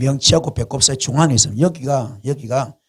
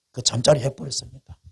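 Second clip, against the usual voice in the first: usual speaking voice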